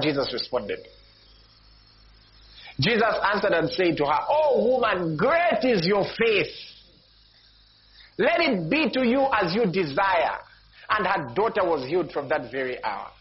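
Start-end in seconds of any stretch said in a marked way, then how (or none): 2.8-12.1 emphatic preaching. Real, not acted. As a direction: stretch the verse out at a raised pitch. Preacher declaims the verse climax.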